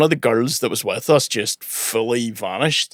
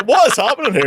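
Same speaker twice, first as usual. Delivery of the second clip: high, incredulous question; pitched-up bewildered disbelief; retold shock at the foam; baffled; 12 semitones higher, 3 dB louder, faster than usual. Confused at foam in cinema.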